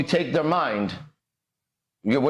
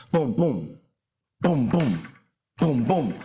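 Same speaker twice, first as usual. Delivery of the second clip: low, steady booming beats; heartbeat booms; 3 semitones lower, slower than usual. Shown as a demonstration. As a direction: thump it out like a heartbeat. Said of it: "should be a step my body takes".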